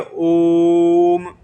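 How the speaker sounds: "ओम" held long on the o, slow and chant-like; a teacher demonstrating the long "ओम"; drawn-out om. Shown as a demonstration. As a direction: draw out "ओम" for three beats. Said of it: "थोड़ा देर तक बोल दिया".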